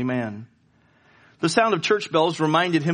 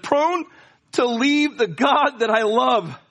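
tearful plea; 10 semitones higher, 4 dB louder, slower than usual. Acted. Tearful poet quoting himself.

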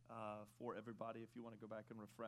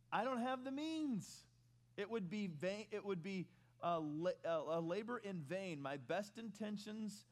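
rising preaching; 4 semitones higher, 4 dB louder than usual. Acted. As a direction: drive the point home from the pulpit. Preaching builds to higher pitch.

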